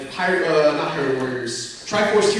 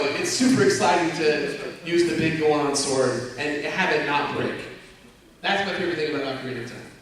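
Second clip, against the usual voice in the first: ordinary talk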